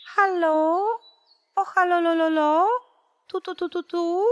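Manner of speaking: high chirpy bird calling into a phone; a little bird answering the radio, high and sing-song; nightingale voice. A narrator's reading. The nightingale greets the radio audience in a high chirp. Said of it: "Pierwszy słowik zaczął tak".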